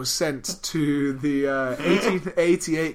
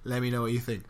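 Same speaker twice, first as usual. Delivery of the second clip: usual speaking voice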